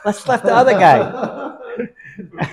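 high, slow laughing shout; laughing call. Retold or imitated. Dancer calling out in story.

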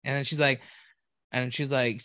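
ordinary talk, nothing marked

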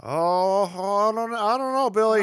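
put-on worried high kid voice. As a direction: hesitate like a timid kid. Plays nervous kid.

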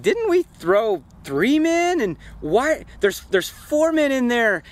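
high, drawn-out disbelief; astonished king. Acted. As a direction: act out a baffled king, pitch jumping up.

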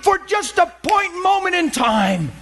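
high impassioned preaching; preaching at full force. Acted. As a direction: drive the point home to the congregation.

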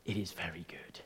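near-whisper, very soft and low; hushed quote. Retold or imitated. Preacher quotes God looking at creation, hushed whisper. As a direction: drop to an awed hush.

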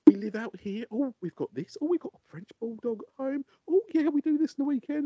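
high, bubbly chatter imitating the wife; gushing wife. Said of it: "Wife's going".

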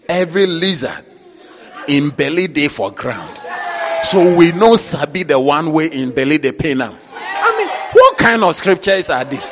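loud, laughing pidgin mockery; laughing outraged shout; mocking shout. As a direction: shout in disbelief at the proverb.

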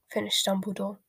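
ordinary delivery

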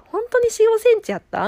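high, loud incredulous question; retold disbelief. The staff doubting her dilation, loud high disbelief. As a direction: sound stunned that the check was wrong.

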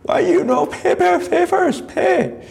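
mock Korean accent, shrieking at a thief; Korean shopkeeper accent. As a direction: play a frantic Korean store owner yelling in accent. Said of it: "the Korean owner, like".

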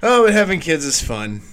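normal talking voice

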